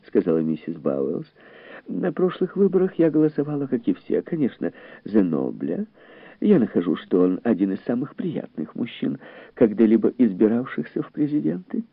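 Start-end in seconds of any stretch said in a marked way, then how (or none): 1.9-11.8 high, light female character voice; high-voiced lady making small talk; chatty society woman. A narrator's reading. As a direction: play an agreeable lady guest, pitched up.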